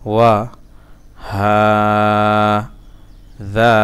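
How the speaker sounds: separated syllables with long pauses. Shown as a demonstration. Teacher breaking a word down for learners, syllable by syllable. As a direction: sound out one syllable at a time.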